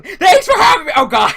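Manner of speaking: high, loud overexcited shout; mock shout. Mocking the contestant greeting the host, shouting. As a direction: yell it like a hyped-up contestant. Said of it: "He's like".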